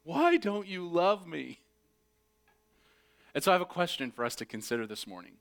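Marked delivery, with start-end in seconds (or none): 0.0-1.6 slow, higher lament, short of a scream; plaintive cry. Retold or imitated. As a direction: echo the wail slowly, held back. Repeats the scream, toned down.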